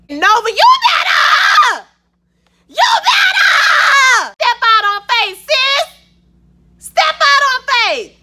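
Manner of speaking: high, loud, slow shouted cheer; real cheering for Nova's resignation, near crying; ecstatic cheering. Real, not acted. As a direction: shout it like you're at church.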